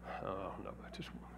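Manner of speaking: whispered under his breath; whispered aside. Retold or imitated. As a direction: hush it, grumbling privately about his wife. Muttered aside about his wife.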